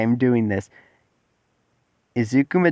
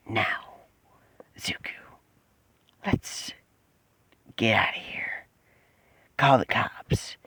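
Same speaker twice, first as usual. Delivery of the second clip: hushed whispered creature voice; slow whisper, broken by pauses; the Bat; 3 semitones lower, 8 dB quieter, slower than usual. Acted. Creature tells Zuko to leave and call police, whispered creature voice.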